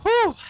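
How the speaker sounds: high, loud falsetto "whew"; sharp, high exhale-whoop after the excitement; relieved whew. Real, not acted. Relief after the frantic call.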